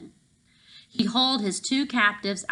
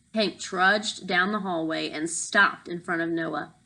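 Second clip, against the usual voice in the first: plain reading voice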